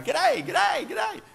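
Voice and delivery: loud, high cheery g'day called out; Aussie greeting. Retold or imitated. Aussie traveller greeting locals loudly. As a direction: overdo the Aussie hello. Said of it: "I'm the Aussie, so I'm going".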